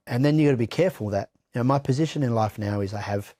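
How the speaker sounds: ordinary delivery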